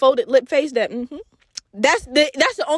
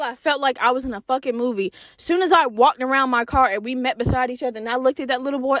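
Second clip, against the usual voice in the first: ordinary talk, nothing marked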